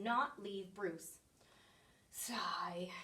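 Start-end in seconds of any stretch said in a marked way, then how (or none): 2.1-2.9 weary whispered sigh. Acted. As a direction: breathe it out like giving up. Bruce gives up on geese.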